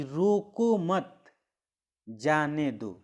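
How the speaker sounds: रोको मत, a clear pause, then जाने दो; comma pause. Shown as a demonstration. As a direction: break after मत before saying जाने दो. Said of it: "अल्प समय के लिए वहाँ पे रुकना पड़ता है". (मत के बाद थोड़ा रुककर)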